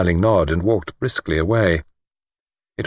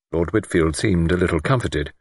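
ordinary delivery, nothing marked

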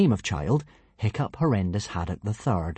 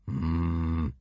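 low, soft, pondering hum; absent-minded rumination; thoughtful; 5 semitones lower, 5 dB quieter than usual. A narrator's reading. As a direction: hum low, mulling it over.

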